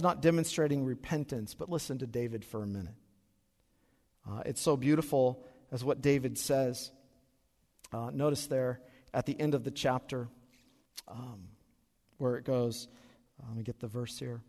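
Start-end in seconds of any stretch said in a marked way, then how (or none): none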